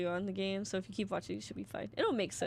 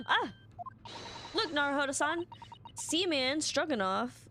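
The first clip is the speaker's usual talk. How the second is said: higher, eager character voice; excited game assistant; 7 semitones higher than usual. Acted. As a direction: call out the discovery eagerly. Reads the game dialogue aloud.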